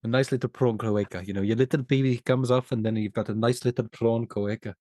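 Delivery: hammed-up South African accent; cheeky mock South African voice; Sharlto Copley. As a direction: playfully imitate a South African actor. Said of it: "I'm doing an impression of Sharlto".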